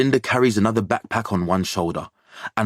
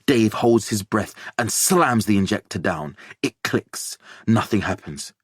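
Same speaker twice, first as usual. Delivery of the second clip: plain reading voice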